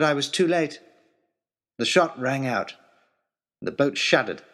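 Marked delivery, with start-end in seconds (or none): none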